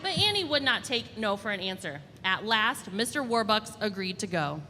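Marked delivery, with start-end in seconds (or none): none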